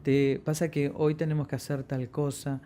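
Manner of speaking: soft, under-projected talking; hard to hear. Shown as a demonstration. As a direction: say it too quietly to be understood. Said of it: "está hablando y no le estás entendiendo".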